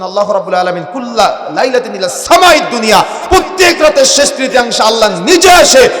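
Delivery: proclaimed recitation swelling to a shout; forceful preaching. Real, not acted. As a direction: build the hadith into a loud proclamation. Proclaims a hadith to congregation.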